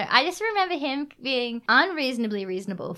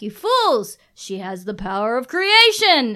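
villain Darkness; 2 semitones higher, 7 dB louder, slower than usual. Retold or imitated. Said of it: "Darkness is like".

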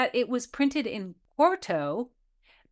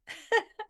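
high mocking laugh; 11 semitones higher than usual. Laugh alone.

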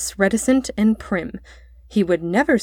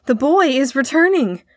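higher answering call; raised voice, passing the cry along; echoed cry; 6 semitones higher, 4 dB louder than usual. A narrator's reading. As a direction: repeat the news as other voices calling. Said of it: "who repeated".

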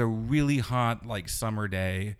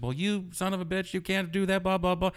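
higher, mock-indignant snap; retold angry retort; 8 semitones higher than usual. Girl snapping at the heckler, raised pitch. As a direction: play the offended girl on the bus. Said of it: "she turns to him and says".

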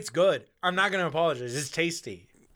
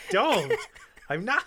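laughing protest; 9 semitones higher, 5 dB louder than usual. Real, not acted.

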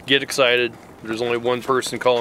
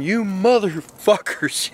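retold scream; 7 semitones higher, much slower than usual. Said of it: "start screaming".

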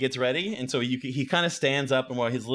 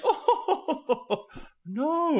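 high hooting chuckle; high-pitched hooty laughing voice; laughing owl. Acted. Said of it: "The owl laughs".

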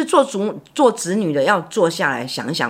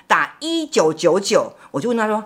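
ordinary talk, nothing marked

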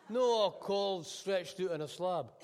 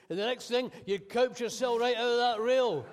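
higher and louder, building to a near shout; loud cabbie rant; 6 semitones higher, 8 dB louder than usual. Acted. Cabbie warning his passenger about jumping, loud and rising. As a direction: push it up as he warns her off the rail.

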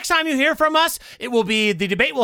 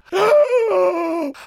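high shouted gasp; mock audience gasp; 7 semitones higher than usual. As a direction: gasp like a shocked crowd. Audience gasps!.

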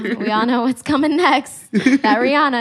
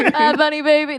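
laughing imitation; 6 semitones higher than usual. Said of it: "That's, like, the only thing you know".

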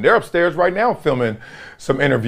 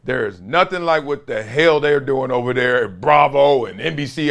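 ordinary talk

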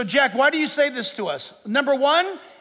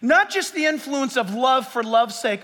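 normal talking voice

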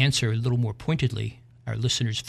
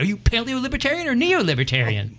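woman's voice far up, eager; high eager woman; 10 semitones higher than usual. Acted. Woman on bridge quizzes the man, high and eager. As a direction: quiz him eagerly in a high voice. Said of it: "she said".

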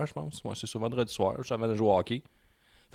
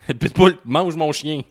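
louder, slower, insisting it's not his fault; indignant protest; 5 semitones higher, 7 dB louder, slower than usual. Real, not acted. To his co-host, defending himself: indignant and emphatic. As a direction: plead your case, a bit exasperated.

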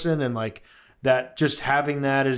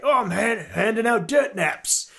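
Dengar, British Cockney; 9 semitones higher than usual. Retold or imitated. Fan quoting Dengar's voice acting from the game, mock Cockney accent. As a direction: imitate a Cockney game character, pitched way up. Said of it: "he was a Brit".

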